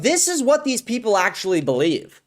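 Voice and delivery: ordinary talk, nothing marked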